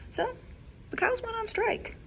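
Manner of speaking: ordinary delivery, nothing marked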